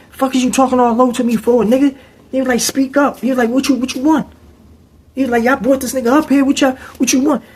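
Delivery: high, confrontational, demanding; mock aggressive inmate. Dude in the cell confronting the roommate, high mock voice. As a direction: get in his face.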